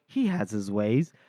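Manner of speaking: low, slow voice from nowhere; acted eerie interjection; mysterious voice. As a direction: intone it slowly and low, ominously. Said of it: "we hear a voice that goes".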